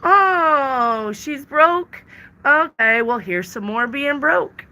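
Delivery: mock-surprised voice of the universe. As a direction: play the universe, mockingly surprised. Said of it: "the universe is gonna be like".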